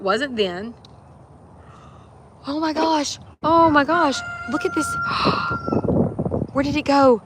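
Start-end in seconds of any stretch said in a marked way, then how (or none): 2.5-4.9 high, loud, thrilled exclamations; excited. Real, not acted. Spots a beautiful bluebird. 4.9-6.5 sharp high gasp of wonder; delighted gasp. Real, not acted. Sees the bluebird. 6.5-7.2 high, loud, thrilled exclamations; excited. Real, not acted. Spots a beautiful bluebird.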